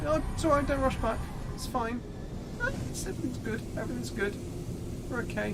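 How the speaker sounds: airy, overly cheerful reassurance; mock-sweet voice telling friends not to hurry back; imagined sweet reply.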